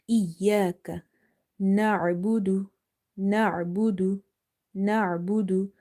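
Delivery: na'budu with the ain from mid throat, slow; slow careful na'budu. Shown as a demonstration. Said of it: "Ain is from the middle of the throat".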